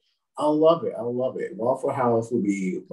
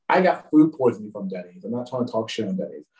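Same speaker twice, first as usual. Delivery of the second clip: ordinary delivery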